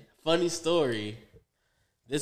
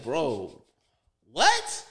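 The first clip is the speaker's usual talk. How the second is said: baffled outburst; 4 dB louder than usual. Retold or imitated. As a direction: hit "what" like you can't believe your ears. Baffled at cop's munchies theory.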